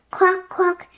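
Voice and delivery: high quacking bird cry; a bird crowing quack quack; crowed bird call. A narrator's reading. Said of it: "he crowed".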